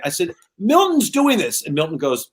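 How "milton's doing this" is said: indignant protest. Retold or imitated. Kid protesting slowly.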